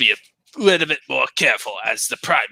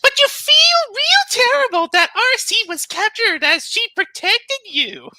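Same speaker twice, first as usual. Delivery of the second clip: put-on cute anime girl, pitched way up; 4 dB louder than usual. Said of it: "I'm gonna try to sound like a anime girl".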